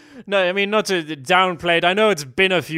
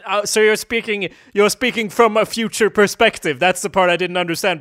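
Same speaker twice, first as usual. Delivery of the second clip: usual speaking voice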